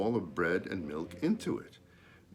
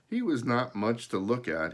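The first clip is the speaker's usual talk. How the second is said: usual speaking voice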